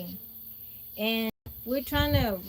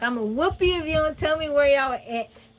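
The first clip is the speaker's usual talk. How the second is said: raised, angry threat, a bit higher; threatening aunt; 4 semitones higher, 7 dB louder than usual. Retold or imitated. Aunt threatening niece with a whooping, loud mock anger. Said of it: "My aunt is mad".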